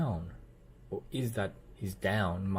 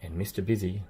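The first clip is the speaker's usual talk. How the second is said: plain reading voice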